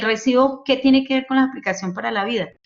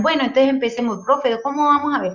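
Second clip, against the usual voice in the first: imitating eager students; 6 semitones higher than usual. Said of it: "Y ellos me decían".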